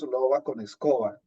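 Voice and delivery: normal talking voice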